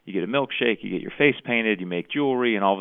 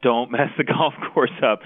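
louder, higher repeat with a laugh; 5 semitones higher, 5 dB louder than usual. Retold or imitated. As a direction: laugh through the repeated plea. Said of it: "the players kept saying".

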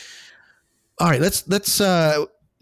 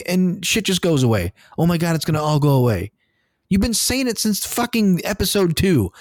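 exasperated rant; 7 semitones higher than usual. Real, not acted. Fan venting to his co-host about a wasted season. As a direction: vent like a fan whose time was wasted.

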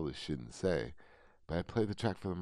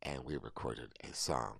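ordinary talk, nothing marked

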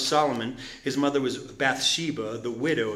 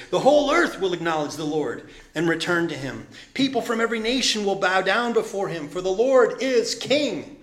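from calm plea up to higher, louder praise; building scripture reading; 4 semitones higher, 3 dB louder than usual. Acted. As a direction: climb to a triumphant close. Reads psalm building to praise.

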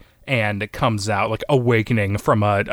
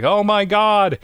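much higher, louder horrified exclamation; shocked gasp; 10 semitones higher, 4 dB louder, slower than usual. Acted. Riders reacting to a bloody death, much higher shocked cry. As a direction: blurt out horror. Riders watching comrade explode.

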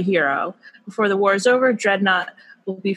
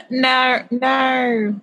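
higher, drawn Australian "naur, naur"; playful Aussie-accent parody; mock Australian; 4 semitones higher than usual. Reader joining the co-host's joke, mock Australian accent. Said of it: "practicing my Australian accent".

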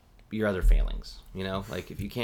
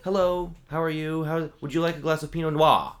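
higher, louder server greeting, "Noir" ending in wah; imitated coworker; 7 semitones higher, 6 dB louder than usual. Coworker greeting a guest, high mocking imitation. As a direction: greet brightly, then overdo "Noir" without its R. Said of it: "a bartender that I work with".

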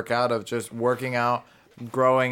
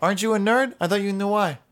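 high, loud mocking jab; teasing; 9 semitones higher, 4 dB louder, faster than usual. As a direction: needle the nerd. Coach teasing nerd.

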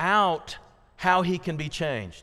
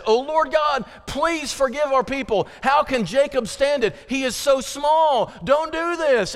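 pleading prophet; 8 semitones higher, 3 dB louder than usual. Acted.

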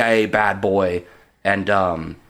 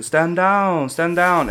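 higher, drawn commander's order; Tommy Wiseau; 6 semitones higher, much slower than usual. Retold or imitated. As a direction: lightly imitate a commander calling his people off. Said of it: "the Tommy Wiseau guy was telling his people".

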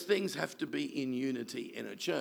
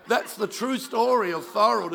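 louder, higher, firm; emphatic declaration; 7 semitones higher, 9 dB louder than usual. Real, not acted. Pastor insisting to the congregation the story is true, loud. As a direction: declare it to the whole room.

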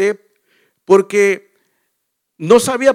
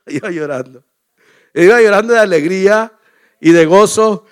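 a real laugh breaking into the words, then louder; laughing joy spilling over; laughing while telling; 6 dB louder than usual. Real, not acted. Pastor laughs telling the congregation he wept with joy.

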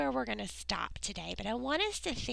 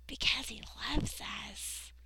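whisper; 4 dB quieter than usual. Real, not acted. Tender answer to why.

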